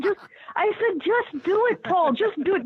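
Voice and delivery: high-pitched retold urging; re-enacted insistent plea. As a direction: urge him on, pitched well up. Retelling her urging of golfer.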